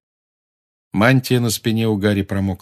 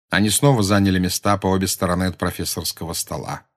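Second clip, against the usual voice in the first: plain reading voice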